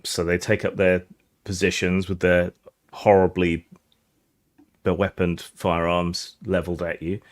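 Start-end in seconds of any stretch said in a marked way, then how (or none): none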